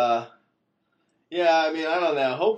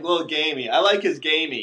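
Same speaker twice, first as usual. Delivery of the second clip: playful; 3 semitones higher, 8 dB louder than usual. Acted. Joking about player named Lamb.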